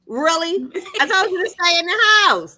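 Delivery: high, loud disbelieving scold; exasperated scolding. Retold or imitated. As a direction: shout it like a fed-up daughter. Scolding mom who caught covid.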